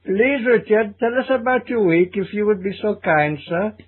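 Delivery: high, hammed-up Indian accent; overly polite comic Indian accent; comedy Indian accent. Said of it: "Putting on a comedy Indian accent".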